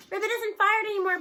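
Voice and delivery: loud, high, thrilled yelling; a kid yelling with joy; excited yell. Acted. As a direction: shout the good news across the room. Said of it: "Parker yells at her".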